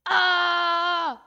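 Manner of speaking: a loud, anguished cry of defeat; frustrated yell. Real, not acted. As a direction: howl at the crash. Crashes and loses the run.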